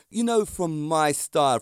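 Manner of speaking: usual speaking voice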